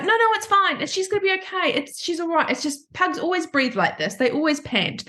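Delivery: high, pleading brush-off; pitched high, refusing to hear it; anxious denial. Retold or imitated. Owner brushing off the vet's warning about her panting pug, high anxious denial. Insisting to vet pug is fine.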